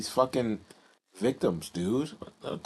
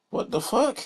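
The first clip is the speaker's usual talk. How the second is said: shocked disbelief; 13 semitones higher than usual. Real, not acted. After Max's promise, outraged disbelief. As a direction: blurt it out in disbelief.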